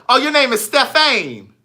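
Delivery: loud, high exclamation of discovery; surprised realization. Real, not acted. Host learning the viewer's real name, loudly surprised.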